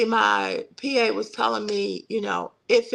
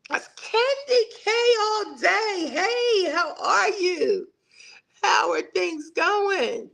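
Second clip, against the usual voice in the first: excited greeting; 8 semitones higher, slower than usual. Real, not acted. Greeting Candy K on the live, an excited, drawn-out hello. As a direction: call out a delighted hello.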